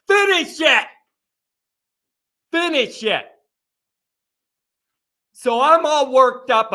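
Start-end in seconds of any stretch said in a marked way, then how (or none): 0.1-0.9 shout. Real, not acted. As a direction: yell it at the team to close the game. Host firing up Notre Dame fans.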